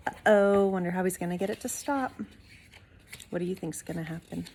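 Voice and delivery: ordinary delivery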